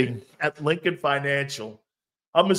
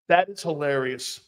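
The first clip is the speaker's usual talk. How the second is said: ordinary delivery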